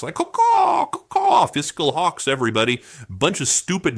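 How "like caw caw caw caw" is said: high squawking bird call; mock hawk caw. Mocking fiscal hawks with a high squawking caw. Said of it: "they call themselves the hawks".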